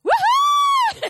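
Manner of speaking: long, high, loud whoop; celebratory whoop. Real, not acted. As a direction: let out a piercing cheer. Celebrates news of entering politics.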